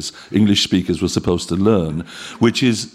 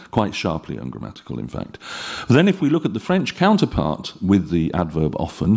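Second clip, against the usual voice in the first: ordinary delivery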